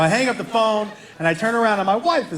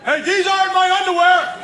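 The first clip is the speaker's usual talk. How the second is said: put-on high indignant cry of a teen boy, laughing through it; a kid discovering the wrong underwear; high, startled outrage; outraged son; 9 semitones higher, 3 dB louder than usual. Son finds panties, voiced.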